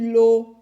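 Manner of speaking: "l'o" loud, with a long L and held O; syllable sounded out. Shown as a demonstration. Teacher contrasting syllables for children, "l'o" loud and stretched. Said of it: "pour entendre o".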